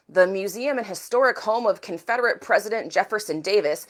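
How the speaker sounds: ordinary talk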